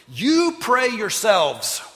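loud, high, slow in-your-face retort; emphatic shout. Retold or imitated. Simon snapping back at Peter, loud and slow. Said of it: "he may not have said it with that kind of tone".

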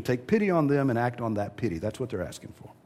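ordinary talk

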